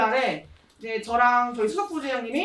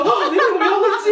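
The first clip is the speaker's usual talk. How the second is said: higher, louder, laughing through the words; laughing quote; 8 semitones higher, 8 dB louder than usual. Retold or imitated. Retelling her stunned reply, laughing loudly through it. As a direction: laugh through the question at yourself.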